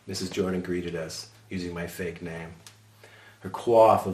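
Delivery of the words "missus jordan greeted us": usual speaking voice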